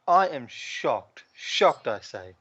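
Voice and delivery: sarcastic. Acted. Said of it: "mock shock at hardcore blood".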